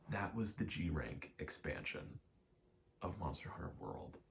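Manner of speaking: usual speaking voice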